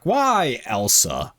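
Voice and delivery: usual speaking voice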